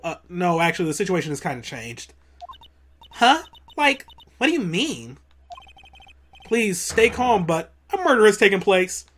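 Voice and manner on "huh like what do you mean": put-on Valley Girl voice. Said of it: "I gotta do a Valley Girl voice".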